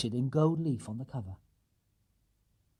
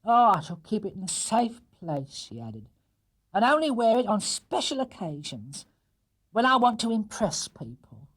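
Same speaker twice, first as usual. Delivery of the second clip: higher, pleased and prim; higher, pleased housekeeper; proud housekeeper; 8 semitones higher than usual. Acted. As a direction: treasure the brooch. Mrs Bird delighted.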